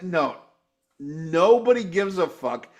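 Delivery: usual speaking voice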